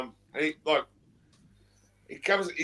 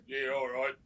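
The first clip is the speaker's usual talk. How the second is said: ordinary delivery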